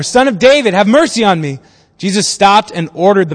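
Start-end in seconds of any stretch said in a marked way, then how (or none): 0.0-1.6 louder, higher cry while reading aloud; insistent raised call; narrated shout. A narrator's reading. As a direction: shout it all the more, within a reading. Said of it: "he shouted all the more".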